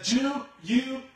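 loud, high call across the falls; yelling to the crowd. Retold or imitated. Tightrope walker shouting to the crowd below, loud and high. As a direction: shout it so thousands can hear. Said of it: "he turned to the crowd and he said".

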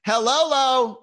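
high, loud, goofy greeting; exaggerated high-pitched weird hi; weird hello. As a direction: holler a silly hello back. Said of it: "you're saying hi in a weird way".

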